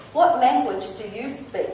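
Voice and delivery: ordinary delivery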